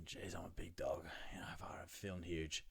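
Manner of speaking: unvoiced inner-thought whisper. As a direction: breathe it out as a private thought. Inner thought while lifting.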